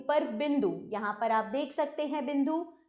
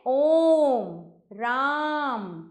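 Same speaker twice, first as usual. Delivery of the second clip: ओम called out loud, राम drawn out very slowly; long held calling vowels; prolonged call; 4 dB louder, much slower than usual. Shown as a demonstration. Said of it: "पुकारते समय किया जाता है".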